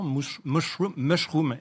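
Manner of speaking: mushroom said with an exaggerated English accent; forced English accent. Shown as a demonstration. Linguist demoing to the hosts, mushroom in a forced English accent. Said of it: "il faut un peu forcer le trait".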